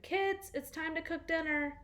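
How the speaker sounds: high, ringing call up the stairs; hollered. Acted. Father calling his kids down for dinner, hollered.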